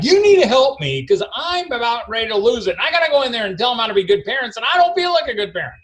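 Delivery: desperate dad begging for help before he cracks; frantic pleading. Retold or imitated. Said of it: "And I'm like".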